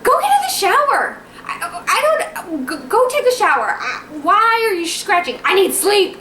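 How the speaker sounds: high, whiny exasperated ordering, retold; exhausted, stressed outburst. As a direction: act out a worn-out wife losing patience.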